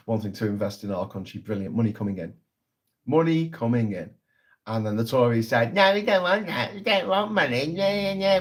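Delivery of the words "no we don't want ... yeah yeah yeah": higher, sneering posh-politician impression; mock Tory voice. Vlogger mocking Tories refusing foreign money, sneering.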